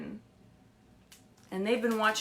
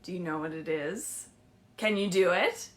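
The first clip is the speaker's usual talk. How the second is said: ordinary delivery, nothing marked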